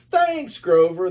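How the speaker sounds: high, loud cheer; children shouting. A narrator's reading. Children thanking Grover, loud and high. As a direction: call it out together. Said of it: "they shouted".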